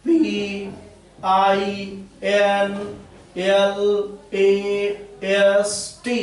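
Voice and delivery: letter by letter with pauses; spelling out. Shown as a demonstration. Teacher spelling a word out for students, slow and separated. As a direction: spell it slowly, one separate letter at a time. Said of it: "इस ढंग से".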